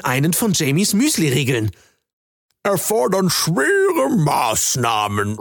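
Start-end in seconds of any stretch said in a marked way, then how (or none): 2.6-5.4 high, very slow frog creature voice; a sly frog drawing out his words. Acted. Frog creature greets Jamie across the pond, high slow drawl.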